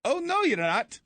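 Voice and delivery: high Irish-accented mock protest; playful Irish accent, teasing. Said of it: "To give me an Irish accent, please".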